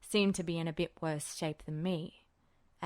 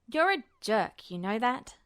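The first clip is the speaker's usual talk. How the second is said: put-on higher, louder embarrassed young guy; flustered young man voice; 6 semitones higher, 6 dB louder than usual. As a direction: blurt it out, flustered and defensive.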